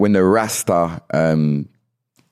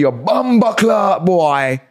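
high, drawn-out mock insult; mock Jamaican insult; 10 semitones higher, much slower than usual. Shown as a demonstration. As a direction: throw it out slowly, pitched way up. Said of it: "So you could say".

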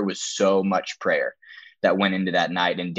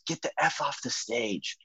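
higher, softened imitation of a yell; mock yell; 4 semitones higher, 7 dB quieter than usual. Kid in the crowd heckles the preacher on stage, mock yell. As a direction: imitate a distant heckler's yell without real volume. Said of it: "some kid yells from the".

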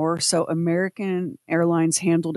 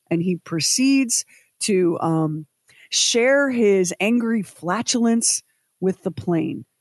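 ordinary talk

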